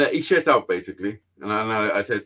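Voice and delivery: usual speaking voice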